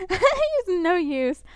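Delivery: laughing it out, slow and amused. Real, not acted. Laughing at a friend who is no help. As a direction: chuckle through the words at him.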